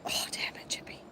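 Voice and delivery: hushed curse under the breath; whisper. Real, not acted. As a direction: whisper it so the squirrel won't hear. Hiding from startled squirrel.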